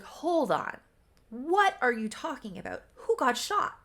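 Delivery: high-pitched mock bewilderment; taken aback. Acted bewilderment at an accusation.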